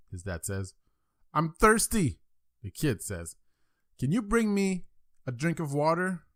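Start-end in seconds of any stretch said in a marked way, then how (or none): 1.3-2.2 put-on higher-pitched child voice; complaining kid. Small boy tells his dad he's thirsty, complaining kid. 4.0-6.2 put-on high-pitched child's request; pleading kid. Small boy asks dad for water from bed, pleading kid.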